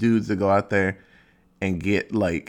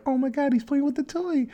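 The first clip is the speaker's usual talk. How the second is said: much higher, gushing over the cat; excited cat owner; 3 dB quieter than usual. Retold or imitated. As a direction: squeal with delight at the pet. Owner excited about cat playing.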